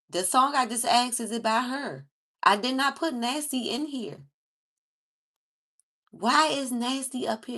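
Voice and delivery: can't believe what popped up, raise it; loud disbelief. Real, not acted. Confused why song popped up.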